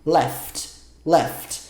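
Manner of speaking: Left said as "laughed", one syllable ending in t; pronunciation demo. Shown as a demonstration. Teacher modelling laughed for students, careful demo. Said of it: "coloca depois do laugh um som de D".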